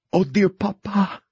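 grief-stricken whisper to her dead father; shaky voice. Acted. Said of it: "she says in a shaky voice".

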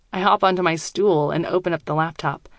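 ordinary delivery

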